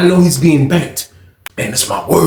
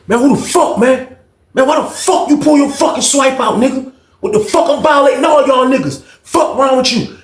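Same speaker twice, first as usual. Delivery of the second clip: angry yell; 8 semitones higher than usual. Retold or imitated. Chris yelling at his homeboy, angry shout. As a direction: tear into him like a furious friend.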